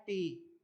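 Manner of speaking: "टी" with an unaspirated t; "टी" as a plain hard t sound; British-style T. Shown as a demonstration. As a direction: say "टी" without a puff of air. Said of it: "British pronunciation के according इसको pronounce करते हैं".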